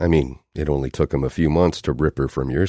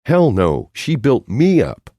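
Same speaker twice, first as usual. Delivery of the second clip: higher and louder, indignant; fiery denial; 9 semitones higher, 6 dB louder than usual. Acted. Noelle defending her friend to Hector, fiery. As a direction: fire back with heat. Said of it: "The fire returned".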